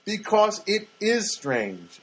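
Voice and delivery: "is" hit hard and drawn out; emphatic stress. Real, not acted. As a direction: spell it out slowly, leaning on "is". Emphatic answer to own question.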